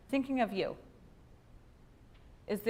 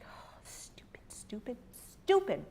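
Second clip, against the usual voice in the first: self-scolding; 3 semitones higher, 6 dB quieter than usual. Retold or imitated. Scolding herself after the call.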